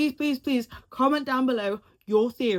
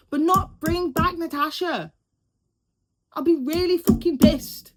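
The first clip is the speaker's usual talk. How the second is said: louder, higher, emphatic fan outrage; fired-up outrage; 8 semitones higher, 7 dB louder than usual. Real, not acted. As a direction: make the fury loud and punchy.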